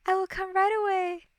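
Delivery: high, composed royal voice; calm, diplomatic princess. Acted. Princess placates the monster, calmly diplomatic. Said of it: "the ex-princess calmed herself and tapped into her many years of".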